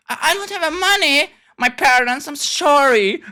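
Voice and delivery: a hint of a sheepish son; voicing ashamed son. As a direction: plead like a broke son caught out. Voicing ashamed son's reply.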